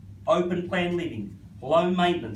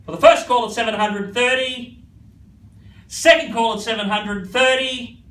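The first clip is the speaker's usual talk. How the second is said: louder and higher with each call; building auction call; 6 semitones higher, 8 dB louder than usual. Real, not acted. Calling first, second, third.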